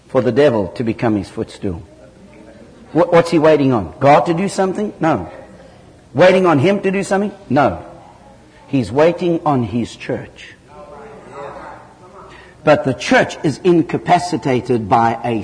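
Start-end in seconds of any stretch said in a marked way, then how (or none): none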